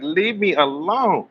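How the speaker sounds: high, slow whiny leave me alone; mock annoyed plea. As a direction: drag it out like a fed-up friend. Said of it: "I'm like".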